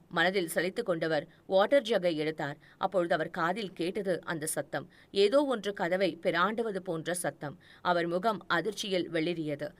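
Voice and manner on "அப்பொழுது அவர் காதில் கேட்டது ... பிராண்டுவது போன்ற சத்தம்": plain reading voice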